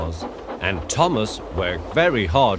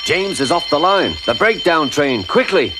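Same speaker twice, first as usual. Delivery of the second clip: urgent alarm; 6 semitones higher than usual. Acted. Alarm raised after the crash.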